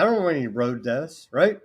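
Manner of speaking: ordinary talk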